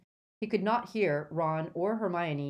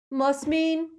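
high, loud scornful echo; ferocity; 11 semitones higher, 7 dB louder than usual. A narrator's reading. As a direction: throw the words back fiercely. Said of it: "turned on Malfoy with undisguised ferocity".

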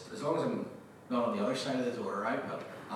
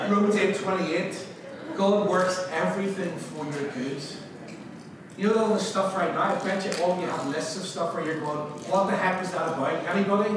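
raised, emphatic sermon voice; preach; 6 semitones higher, 7 dB louder than usual. Real, not acted. Preacher opening a promise to the congregation, emphatic preaching. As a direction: preach it out with force.

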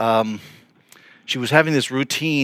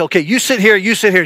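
higher, louder imitation of a bossy woman; stern ordering; 6 semitones higher, 5 dB louder than usual. Bold convert seating visitors in the front row, stern. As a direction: order them firmly into their seats. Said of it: "she was a really stern kind of person".

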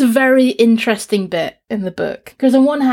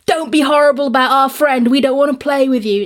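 mock-indignant kids' voice, a bit higher and brighter; indignant children; 5 semitones higher than usual. Children scolding the stranger who insulted their friend, indignant. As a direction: play the offended playmate shutting the stranger out.

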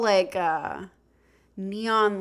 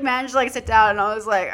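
plain reading voice

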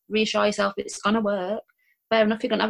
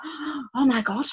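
1 awestruck gasp; 3 semitones higher, much slower than usual. Retold or imitated. Starstruck fan spots her.

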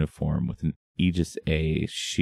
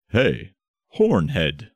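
yelling; 3 semitones higher, much slower than usual. Acted. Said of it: "Gold Star yelled".

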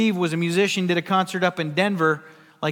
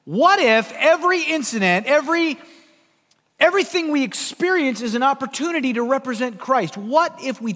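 higher and louder, rising to a passionate challenge; fervent preaching; 8 semitones higher, 6 dB louder than usual. Real, not acted. Preacher challenging believers to represent Christ, high and fervent. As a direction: press the congregation with mounting fervour.

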